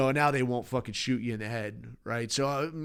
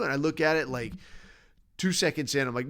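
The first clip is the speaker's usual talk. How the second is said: ordinary delivery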